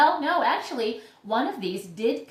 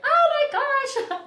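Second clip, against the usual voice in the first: very high, loud, drawn-out mock squeal; high-pitched squeal; 9 dB louder, much slower than usual. Grower acting out finding a new spike, high excited squeal. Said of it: "The voice goes up really extremely high".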